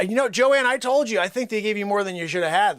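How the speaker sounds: usual speaking voice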